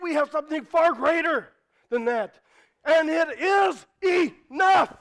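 loud, high, emphatic sermon delivery; fervent preacher building to a shout; impassioned preaching. Real, not acted. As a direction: drive it home, shouting the key declarations. Preaches at full force.

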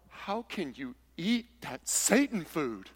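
slow, put-on scandalized church lady voice; drawn-out mock horror at the sinful food; scandalized legalist. Preacher mimics a shocked legalist, slow and scandalized. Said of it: "they're over here going".

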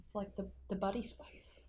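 soft whispered aside; whispering. Real, not acted. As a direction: whisper the joke. Whispered quip.

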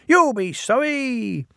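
high, put-on frightened engine; mock whimper. Bill faking fear at the diesel, high and whiny. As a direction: pretend to whimper. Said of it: "he whimpered".

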